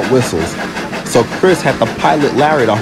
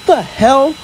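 very high shocked outburst; Larry appalled by the spare whistle; 14 semitones higher than usual. A narrator's reading. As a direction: blurt it out in shock.

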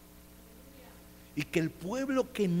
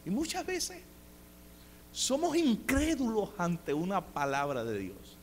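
ordinary delivery

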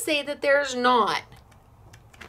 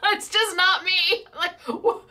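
laughing; 7 semitones higher than usual. Real, not acted. Laughing at her own reaction.

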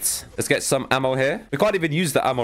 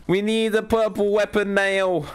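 whining; 8 semitones higher, slower than usual. Real, not acted. Whining for a purple weapon.

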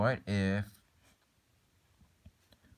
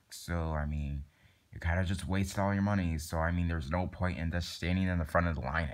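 normal talking voice

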